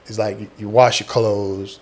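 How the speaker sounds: ordinary delivery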